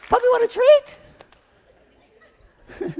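very high falsetto coaxing; puppy baby talk. Retold or imitated. Owner offers his puppy a treat, high baby talk. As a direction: coax a pet in falsetto.

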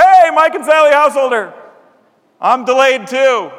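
put-on high, loud delighted hello to old friends; cheerful loud airport reunion; excited greeting. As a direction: greet them like a happy surprise.

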